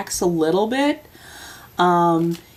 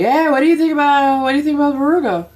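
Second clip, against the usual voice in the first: teasing question; 10 semitones higher, 4 dB louder, faster than usual. Retold or imitated. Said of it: "And I was like".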